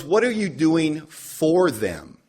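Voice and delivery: sponsor pressing. Retold or imitated. Sponsor pushing the newcomer, slow and pointed. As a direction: lean on each word like a stern sponsor.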